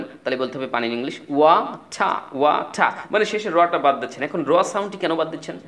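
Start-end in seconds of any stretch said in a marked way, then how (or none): 1.3-2.9 ওয়াটা with the final r dropped, ending on an open "tah"; British pronunciation. Shown as a demonstration. Teacher showing students British water, ওয়াটা with no final r. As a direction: say ওয়াটা slowly, British, with a silent r. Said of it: "British অ্যাকসেন্টে এটাকে আপনি যদি বলেন".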